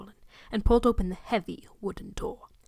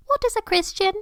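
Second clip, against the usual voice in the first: put-on much higher childish voice, wide-eyed and curious; innocent little boy; 12 semitones higher, 4 dB louder than usual. Boy asking the visitor a question, innocent high child voice. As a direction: ask it like a wondering small child. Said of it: "Harry queried innocently".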